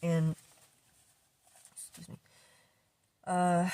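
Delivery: normal talking voice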